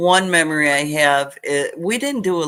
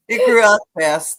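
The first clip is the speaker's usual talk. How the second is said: normal talking voice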